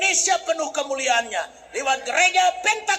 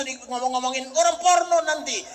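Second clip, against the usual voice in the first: ordinary talk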